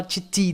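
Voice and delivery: TITI with a full I after each T; wrong vowel after T. Shown as a demonstration. Teacher shows what not to say, vowel after T. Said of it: "Não vai falar".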